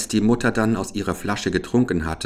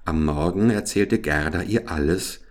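ordinary delivery

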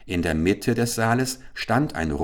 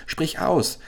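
higher urgent cry again, falling; eager shout repeated; 9 semitones higher than usual. Acted. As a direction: insist once more, pressing. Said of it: "schrie Chasid". (drängend wiederholter Ruf)

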